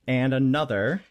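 usual speaking voice

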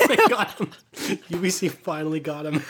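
laughing high and loud; cracking up at the joke. Real, not acted. Joking swat team arrest.

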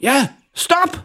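high yelled command into the phone; shouting. Acted. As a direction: yell it at the phone. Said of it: "råber far ned i telefonen".

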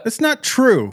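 high indignant denial. Real, not acted. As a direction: protest it, pitch jumping up. Repeats denial, much higher.

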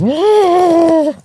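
high squeaky cartoon whoa; panda voice. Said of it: "He goes".

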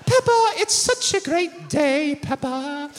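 high sing-song cartoon mum; hammed-up cartoon pig mum; Mama Pig. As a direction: coo it like a cheery cartoon mother. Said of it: "That's Mama Pig".

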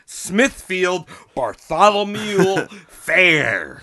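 "Smithfield Bartholomew" slowly, laughing before "Fair"; slow careful pronunciation. Shown as a demonstration. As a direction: drag out "Bartholomew" and laugh through it. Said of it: "One more time".

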